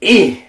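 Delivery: high, loud sulky grunt; retold defiant grunt. Thirteen-year-old boy refusing his mom, high loud defiant grunt. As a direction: grunt like a tough kid refusing. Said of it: "And I go".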